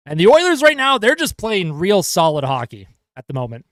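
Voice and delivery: steady hockey play-by-play call; live broadcast commentator voice; play-by-play commentary. Acted. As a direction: call the game for the viewers.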